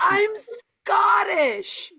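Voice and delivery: high, loud proud shout; mock yell. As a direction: shout it to the night. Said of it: "screaming it into the night".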